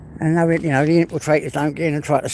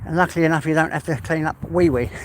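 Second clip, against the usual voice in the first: normal talking voice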